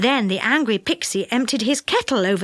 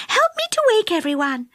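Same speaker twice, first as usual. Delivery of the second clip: high, eager fairy voice; rally helpers in a high, keen voice; pleased; 10 semitones higher than usual. A narrator's reading. Fairy asking friends to help, high and pleased.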